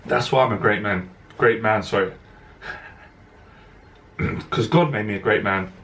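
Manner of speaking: real emotion, low and halting; choked up, voice dropping; moved, clearing the throat between phrases; emotional. Real, not acted. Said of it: "I get emotional".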